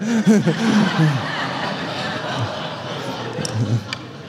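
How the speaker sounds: laughing at his own punchline; hearty laugh. Real, not acted. Preacher laughing at his own story's punchline, warm chuckle. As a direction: let the laugh roll out.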